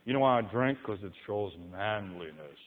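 low, soft mock boast; lightly mock the drinker's excuse. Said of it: "No, you don't".

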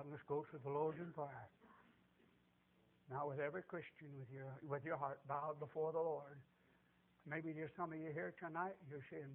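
low, soft pastoral voice; call to prayer. Real, not acted. Preacher calls for bowed heads and prayer, hushed and solemn. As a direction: quietly lead the bowed congregation.

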